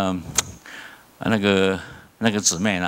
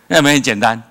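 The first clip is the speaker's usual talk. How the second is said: emphatic question; 4 semitones higher, 8 dB louder, faster than usual. Acted. Preacher punches rhetorical question.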